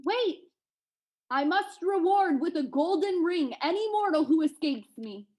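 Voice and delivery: blinded giant shouting after his quarry; giant calling out. A narrator's reading. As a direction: call after someone who is escaping. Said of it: "when he called to me".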